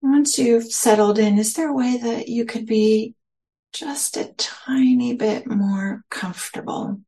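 slow, spacious meditation guidance with long pauses; guided meditation. Real, not acted. Teacher settling listeners into their senses, slow guided meditation. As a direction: lead them gently inward, phrase by unhurried phrase.

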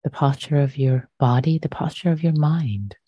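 unhurried, measured meditation voice with long pauses; guided meditation. Real, not acted. Meditation teacher inviting listeners to settle their posture, calm and unhurried. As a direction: guide gently into stillness, leaving long silences.

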